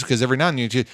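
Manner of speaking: ordinary talk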